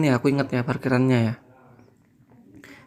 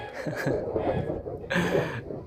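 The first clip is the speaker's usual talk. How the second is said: airy laugh; 4 semitones higher, 9 dB quieter than usual. Real, not acted. Laughing at his own joke.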